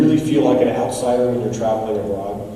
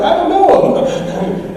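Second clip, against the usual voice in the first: high, slow, laughing disown; denying, laughing; 7 dB louder, much slower than usual. Retold or imitated. Speaker to the stranger beside him, laughing denial. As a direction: back away from him with a laugh. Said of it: "I'm like".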